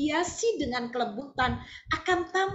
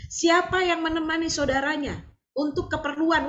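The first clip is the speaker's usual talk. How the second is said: ordinary delivery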